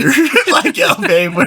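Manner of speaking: giggling through the words; laughing. Real, not acted. Joking about living off his partner, laughing through it. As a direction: laugh out the joke as you say it.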